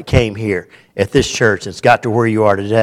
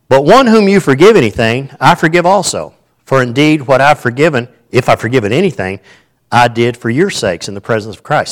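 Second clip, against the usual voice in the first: louder, measured reading of the verse aloud; scripture reading; 6 dB louder than usual. Acted. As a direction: read the passage out to the church. Reads scripture to congregation.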